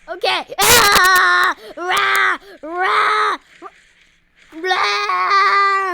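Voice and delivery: high, loud screaming war cries; frantic loud battle shouts; battle yell. Real, not acted. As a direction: roar into the boss fight. Boss fight battle cries.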